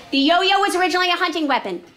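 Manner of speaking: high, loud classroom read-aloud; big, emphatic reading voice. Shown as a demonstration. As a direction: project the text to a noisy room. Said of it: "I'm gonna read aloud".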